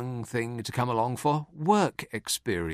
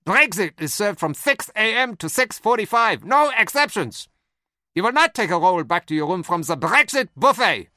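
loud, higher barked German Brexit; German shouting; 6 semitones higher, 6 dB louder than usual. Retold or imitated. Comedian imitating German leaders, loud German accent. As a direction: bark Brexit like a German hotelier. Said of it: "sounds like someone shouting".

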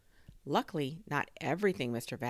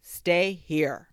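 loud, raised command; calling after it; 4 semitones higher, 10 dB louder than usual. A narrator's reading. Inventor ordering his drone back, loud call. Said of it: "Mister Vasloff called after it".

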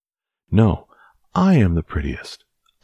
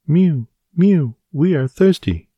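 high squeaky cat chorus voice, louder; mewing cats; 13 semitones higher, 6 dB louder than usual. Acted. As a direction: mew like a crowd of thirsty kittens. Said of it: "cried the hundreds of cats".

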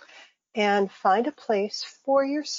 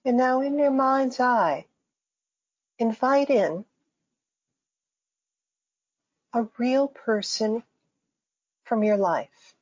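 guided meditation. Acted. Faculty member guiding listeners through breathing and imagery, calm meditation voice. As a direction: lead listeners gently into imagery, phrase by phrase.

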